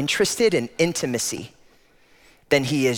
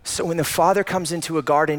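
usual speaking voice

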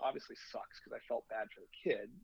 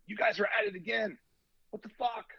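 higher, louder indignant quote; mock the outraged texter, pitched up; irate retold texts; 8 semitones higher, 5 dB louder than usual. Acting out angry texts received.

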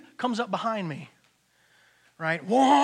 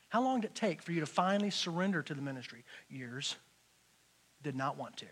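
normal talking voice

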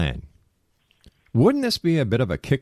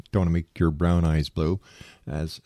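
ordinary talk

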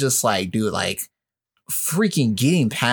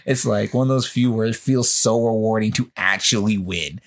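usual speaking voice

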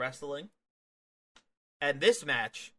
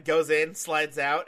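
usual speaking voice

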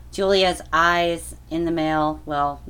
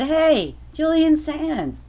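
excited greeting; 11 semitones higher, much slower than usual. Retold or imitated. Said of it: "I would have said".

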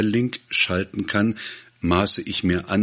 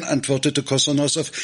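ordinary delivery

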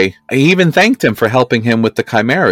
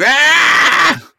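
high mock roar; imitated roar. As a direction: yell it like a mummy roaring. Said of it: "Mum-Ra roar, roaring".

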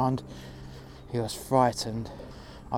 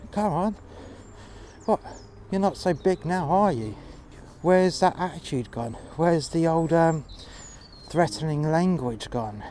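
higher, jeering at a scared man; mocking taunt; 5 semitones higher than usual. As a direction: sneer that he's not so big now.

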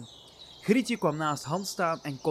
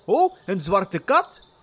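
surprised; 5 semitones higher, 6 dB louder, slower than usual. Acted. Narrator startled by cat.

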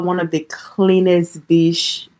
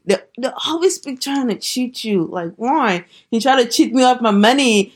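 raised, indignant retelling of being cheated; exasperated complaint; 6 semitones higher than usual. Retold or imitated. Telling listeners about the cheating chocho mate, indignant. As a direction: vent about the mate who won't give change.

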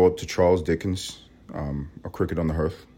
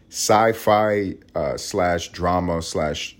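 normal talking voice

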